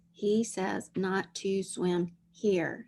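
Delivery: slow dictation. Shown as a demonstration. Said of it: "What's the sentence?".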